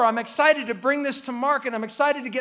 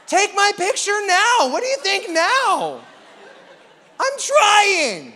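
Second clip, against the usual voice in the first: mock offended; 6 semitones higher, 3 dB louder than usual. Mock offended at wife's comment.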